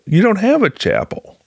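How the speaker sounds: high-pitched, incredulous objection; retold disbelief. Former worker doubts director has a chapel, high incredulous voice.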